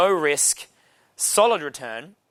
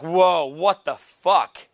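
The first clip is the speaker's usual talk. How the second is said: imitating shocked girl; 4 dB louder, much slower than usual. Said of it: "the girl that I'm holding hands with is like".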